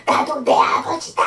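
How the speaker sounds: strained, raspy demon cry; echoing demon voice. Retold or imitated. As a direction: cry out as the demon, dragging the last sound. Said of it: "메아리 치는 거예요".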